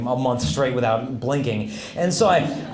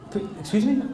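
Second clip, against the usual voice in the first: put-on higher-pitched, puzzled old priest; hard-of-hearing priest; 7 semitones higher than usual. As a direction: query it as if you misheard. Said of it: "priest who was hard of hearing".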